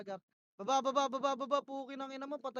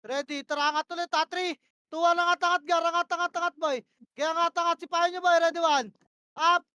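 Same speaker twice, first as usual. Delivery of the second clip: loud, high, urgent calls; yelled commands; 4 semitones higher, 11 dB louder than usual. Real, not acted. Shotcaller screaming push orders mid-fight. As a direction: bark the push at the squad.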